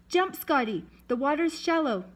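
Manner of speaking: high, urgent call; yelled. A narrator's reading. Sailor to Scotty at the boat, yelling. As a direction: yell an order out over the water. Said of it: "Someone yelled".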